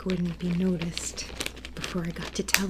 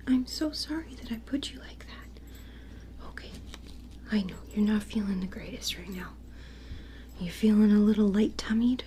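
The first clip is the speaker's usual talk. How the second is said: tender ASMR whisper to a stuffed bear; 3 semitones higher than usual. Acted. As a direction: whisper gently like a toy doctor soothing a patient. ASMR whisper teddy surgery roleplay.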